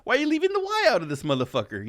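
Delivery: squeaky, outraged disbelief, laughing through it; high, laughing disbelief at a missing Y; incredulous squeal. Retold or imitated. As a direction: shriek in mock outrage, voice flying up. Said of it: "I'm like".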